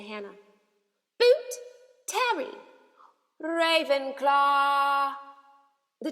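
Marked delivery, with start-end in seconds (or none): 1.2-2.6 high, loud name call. A narrator's reading. Name called out. 3.4-5.1 slow, high, loud booming hat; hat shouting. A narrator's reading. The Sorting Hat announces house.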